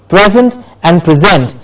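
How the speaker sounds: PREsent, then preSENT; stress contrast. Shown as a demonstration. Teacher introducing noun and verb, stress shifting on present. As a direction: say present stressed first in front, then at the back. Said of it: "Do you hear the difference?".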